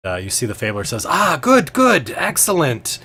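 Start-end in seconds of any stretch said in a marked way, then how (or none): 1.1-3.0 high, drawn-out showman's welcome; theatrical master of ceremonies, pitched up; delighted host. Acted. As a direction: welcome the volunteer grandly in a high voice. Fabler welcomes the volunteer.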